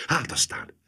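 breathless whisper; panting feverishly. A narrator's reading. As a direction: gasp it out, feverish. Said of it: "lihegte lázasan, izgatottan".

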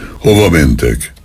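plain reading voice

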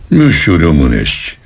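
ordinary delivery

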